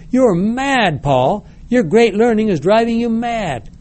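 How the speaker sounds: high, sharp shout of disbelief. A narrator's reading. Festus shouts at Paul that he is mad, high shout. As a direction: cry out that Paul has lost his mind. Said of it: "Festus shouted him".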